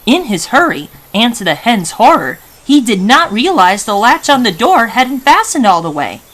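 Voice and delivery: usual speaking voice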